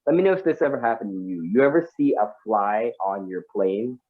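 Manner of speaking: ordinary delivery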